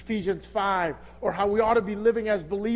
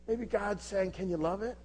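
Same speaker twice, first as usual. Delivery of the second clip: ordinary talk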